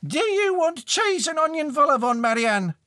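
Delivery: very high screech from downstairs; high-pitched household holler; shrieking mother. A narrator's reading. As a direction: yell it up the stairs in a piercing mum voice. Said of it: "A voice shrieked from downstairs".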